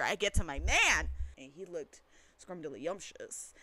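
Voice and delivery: ordinary delivery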